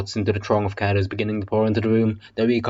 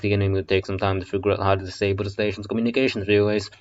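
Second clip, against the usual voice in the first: ordinary delivery, nothing marked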